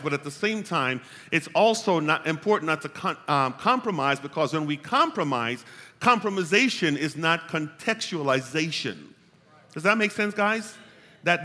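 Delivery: loud, high-pitched sermon delivery; full-force preaching. Real, not acted. Preacher urging the church to contextualize without compromise, full-force preaching. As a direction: preach it out loud and high, driving the point home.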